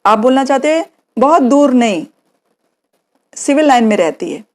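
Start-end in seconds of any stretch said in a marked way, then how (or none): none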